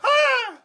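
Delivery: very high, loud shriek; scream. Shown as a demonstration. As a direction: scream on cue. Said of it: "Can you scream on call?".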